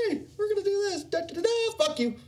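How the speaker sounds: very high, sing-song brush-off; mock smug exec. Mocking Blizzard's announcement: high sing-song brush-off. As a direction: toss it off like a smug boss. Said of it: "fucking really?".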